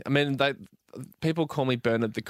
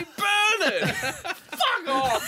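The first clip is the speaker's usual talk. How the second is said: laughing mock shout; slower than usual. Mock heckling himself as Bernard.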